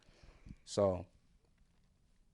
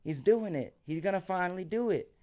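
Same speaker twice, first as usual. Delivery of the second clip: higher, louder cheering; cheering friends; 7 semitones higher, 4 dB louder than usual. Retold or imitated. Friends cheering him on to drink, higher and louder. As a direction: whoop him on. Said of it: "They're like".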